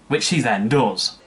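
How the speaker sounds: ordinary talk, nothing marked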